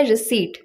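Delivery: रिसीट with silent p, ri-seet; correct pronunciation. Shown as a demonstration. Teacher giving the correct रिसीट, silent p, ri-seet. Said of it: "इसकी correct pronunciation होती है".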